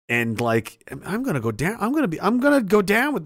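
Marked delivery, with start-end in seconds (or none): none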